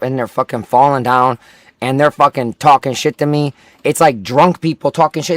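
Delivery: ordinary talk, nothing marked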